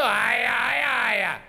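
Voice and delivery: drawn-out mob yammer; angry mob clamour. Shown as a demonstration. Host showing audience the angry mob, hammy clamour. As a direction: holler like a riled-up crowd. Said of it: "you go".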